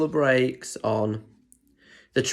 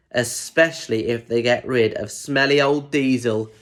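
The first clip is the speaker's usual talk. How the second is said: usual speaking voice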